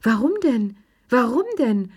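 high, swooping protest; protesting question. A narrator's reading. Madame Peloux objecting to the baroness's scolding, protesting.

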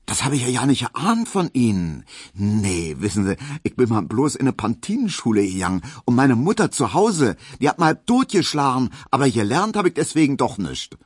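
high, animated Berlin dialect; frank Berlin woman. Acted. Hedwig admits her poor schooling to her suitor, frank and lively. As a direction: rattle it out high, emphatic and unabashed.

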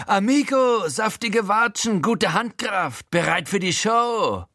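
high, slow, drawn-out showman gangster; großspuriges Lob. A narrator's reading. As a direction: relish the praise with swagger. Frankie lobt großspurig die Ohrfeige. (hohe, gedehnte Gaunerstimme)

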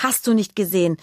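ordinary delivery, nothing marked

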